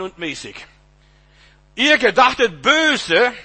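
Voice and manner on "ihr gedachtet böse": loud, high, slow declamation; drawn-out pulpit accusation; forceful preaching. Real, not acted. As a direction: proclaim it slowly at raised pitch. Preacher sets evil against God's good.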